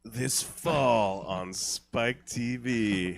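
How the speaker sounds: slow, deep movie trailer voice; trailer announcer. Acted.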